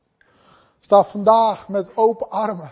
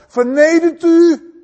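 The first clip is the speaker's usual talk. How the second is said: loud, higher, slow divine command; 6 semitones higher, 6 dB louder, slower than usual. Acted. God ordering the proud to bow, commanding. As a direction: proclaim it slowly and forcefully. Voices God's solemn command.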